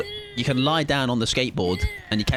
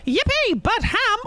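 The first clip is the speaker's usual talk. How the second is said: shrill excited yippee, much higher; high squeaky cheer; slower than usual. Real, not acted. Streamer cheering for his favourite map, high squeal.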